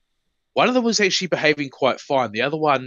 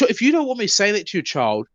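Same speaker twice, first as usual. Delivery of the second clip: ordinary delivery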